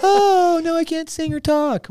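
very high, hammed-up princess lament; mocking Ariel. Mocking the mermaid who trades her voice, whiny and high. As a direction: make fun of a helpless heroine in falsetto. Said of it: "She trades her voice".

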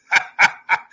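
short amused laugh. Real, not acted. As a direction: laugh briefly between remarks. Chuckles at viewers coming together.

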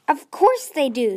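loud, sharp retort; snapped. A narrator's reading. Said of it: "Tsunami snapped".

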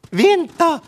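sharp calling-out shout. Acted. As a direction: bird yelling after the rabbit. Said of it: "skrek Tova".

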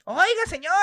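mock child calling up high; high kid's call. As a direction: call out like a small boy. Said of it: "Le digo". (niño gritando "Oiga, señor" con voz aguda)

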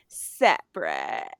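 low, drawn-out, emphatic single word; curt mock reply. Friend snubbing her over the bill, curt. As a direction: stretch it out, loud and low.

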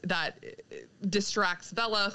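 ordinary talk

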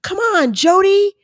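high, loud exasperated self-reproach; annoyed. Retold or imitated. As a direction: scold yourself in frustration, stretching it out. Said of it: "and I'm annoyed".

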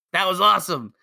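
high, loud gushing praise; hammed-up enthusiasm, loud and high; mock raving fan. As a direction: imitate a fan thrilled by the race. Acted fans raving about races.

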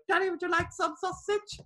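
a grown man playing a wide-eyed child offering sausage; daddy would you like some sausage, high and babyish; Tom Green's sausage line. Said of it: "the great line of".

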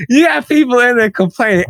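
ordinary talk, nothing marked

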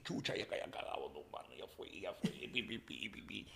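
scared whisper. Retold or imitated. Caught by the cop, whispering scared to himself. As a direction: whisper it under your breath in fear.

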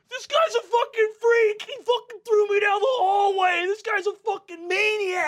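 high, loud mock crying; tearful bully wailing. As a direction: whine hysterically to the onlookers. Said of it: "Are you crying?".

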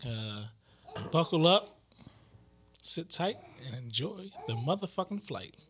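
airline captain over the intercom; calm captain addressing passengers; pilot announcement. Pretend.

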